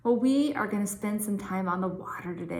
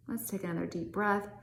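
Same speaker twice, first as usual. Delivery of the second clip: normal talking voice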